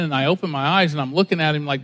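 usual speaking voice

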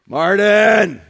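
a loud, glad call across the room. Acted. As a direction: shout the name out to the picture. Calling out to Luther's picture.

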